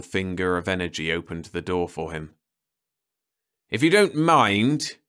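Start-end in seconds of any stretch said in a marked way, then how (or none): 3.7-5.0 slow, higher and louder, bristling; angry. A narrator's reading. Perry to the serval, angrily refusing help. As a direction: snap it out, offended and angry. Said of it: "he said angrily".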